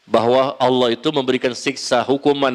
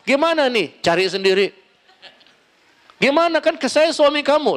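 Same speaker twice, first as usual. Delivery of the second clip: high, raised mock retort of a sulking wife; 10 semitones higher than usual. Wife refusing her husband breakfast, high mock retort. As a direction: toss it back like a wife refusing to cook.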